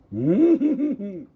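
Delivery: loud high laugh. Real, not acted. Laughs between remarks.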